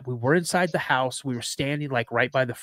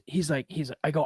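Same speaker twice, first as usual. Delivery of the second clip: normal talking voice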